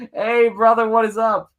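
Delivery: loud, high-pitched welcome to a buddy; hearty greeting. Real, not acted. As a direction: greet an arriving pal at full voice. Host greets arriving guest.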